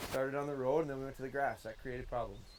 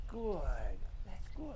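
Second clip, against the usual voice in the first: higher praise for the dog; higher, softer dog praise; 4 semitones higher, 5 dB quieter than usual. Real, not acted. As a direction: reward the dog with a bright "good". Praising dog that came back.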